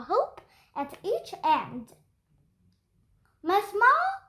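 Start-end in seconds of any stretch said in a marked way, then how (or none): 3.4-4.2 calling out. Acted. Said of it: "called Miss Daisy".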